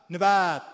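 نود as n'vaad, loud, first vowel swallowed; clipped first vowel. Shown as a demonstration. Teacher demonstrating the Kermani form, نود as loud n'vaad.

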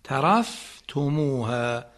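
ترفتموها slow with the fa held silent. Shown as a demonstration. Teacher slowly shows the student the silent fa in ترفتموها. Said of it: "ساکن فا".

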